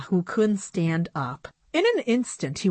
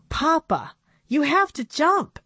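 higher, pleading call to Papa; urgent cry; 10 semitones higher than usual. A narrator's reading. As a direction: urge him to jump, a little desperate. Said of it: "Annabelle cried".

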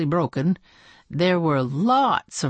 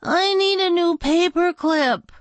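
put-on child; 13 semitones higher, 4 dB louder than usual. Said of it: "Dana speaks".

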